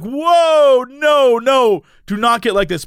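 loud, high protest easing into pleading; mock alarm. Storyteller trying to stop seatmate escalating, mock alarm. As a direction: cut in urgently to halt a row, hammed-up.

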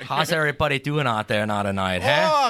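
lightly put-on heavy Pennsylvania Dutch accent. Brother character greeting the audience, hint of Pennsylvania Dutch accent. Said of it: "just a heavy accent".

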